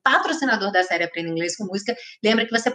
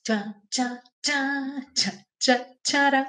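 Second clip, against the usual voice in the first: punchy rhythmic riff syllables, softer than speech; vocalised guitar riff; 5 semitones higher, 3 dB quieter, much slower than usual. Shown as a demonstration. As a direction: imitate a rock riff with "tchá". Imitates the song's guitar riff.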